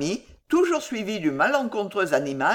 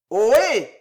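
loud, higher answering holler; shouted reply; 4 semitones higher, 7 dB louder than usual. Acted. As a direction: shout back a hail. Peasant shouts the hail back. (répondre en criant, fort)

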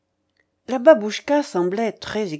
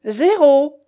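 loud, high announcement; croupier calling out; 6 semitones higher, 9 dB louder than usual. A narrator's reading. Croupier announces the result to players, calling out. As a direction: call the number across the table. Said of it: "appela le croupier".

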